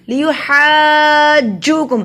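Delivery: "لیحاجوکم" with "haa" held very long on one note; long madd. Shown as a demonstration. Teacher showing three-alif length, "لیحاجوکم" hugely elongated. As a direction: stretch the haa, then land on the doubled j in "لیحاجوکم". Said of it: "لمبا کیا جا رہا ہے".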